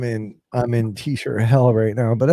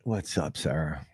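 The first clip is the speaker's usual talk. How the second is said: whisper; 8 semitones lower, 12 dB quieter than usual. Real, not acted. Quiet greeting between hosts.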